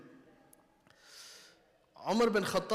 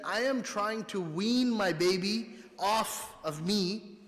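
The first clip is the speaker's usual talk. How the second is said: higher, slow voice of a worn-out mother; 4 semitones higher, 4 dB louder, slower than usual. Retold or imitated. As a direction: voice the weary mother slowly. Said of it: "So she said".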